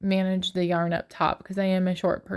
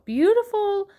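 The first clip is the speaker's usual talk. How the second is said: beautiful sung out much higher and louder; delighted squeal; 11 semitones higher, 4 dB louder than usual. Real, not acted. Admiring the yarn cakes, she gushes a high delighted beautiful. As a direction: gush over the word beautiful.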